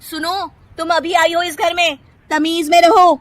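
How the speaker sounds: loud, high, mocking threat; angry threatening sister-in-law. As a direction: put the newcomer in her place. Kirti threatens Nisha. (धमकाती Jethani)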